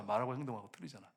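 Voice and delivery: soft, low-pitched repeat of the reproach; subdued echo. Real, not acted. Preacher repeating the charge to churchgoers, quiet and low. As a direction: drop the voice as an afterthought.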